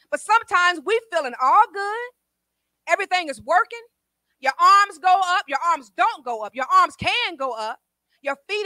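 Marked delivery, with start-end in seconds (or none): none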